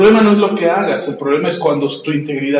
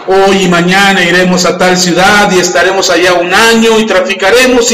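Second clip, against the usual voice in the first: louder, measured Bible reading; scripture reading; 4 dB louder than usual. Acted. Pastor reads Santiago chapter four aloud to the church, proclaiming. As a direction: proclaim the verses to the congregation.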